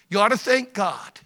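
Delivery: normal talking voice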